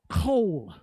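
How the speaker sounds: "Kol" said with a plain k and long o, no d at the end; Hebrew kol. Shown as a demonstration. Preacher teaching the Hebrew word for voice, careful pronunciation. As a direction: say "Kol" like coal, not cold. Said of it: "Not cold as in C O L D".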